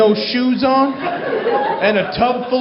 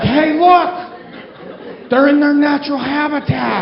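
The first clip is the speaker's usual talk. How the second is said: excited wildlife spotter; 4 semitones higher than usual. Acted. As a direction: marvel as if spotting rare wildlife. Marveling at bus fight like wildlife.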